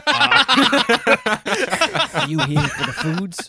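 mocking the pickup guy. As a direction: ham it up like a clueless server.

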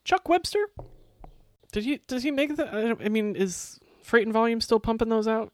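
very high-pitched, baffled query; high mock-puzzled voice, halting; incredulous questioning. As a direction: squeak it out in disbelief. Querying friend's absurd name choice.

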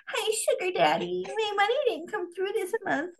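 put-on high, soft, pouty sugar-baby voice. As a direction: play the wheedling sugar baby for laughs. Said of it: "that's how a sugar baby talks".

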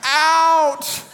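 put-on sudden high, loud little-girl shout; toddler demand. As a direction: toddler switching from sweet to demanding. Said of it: "And then she said".

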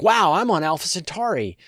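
high, loud mock amazement; excited wow. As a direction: gasp it out like a thrilled tourist. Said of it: "go like".

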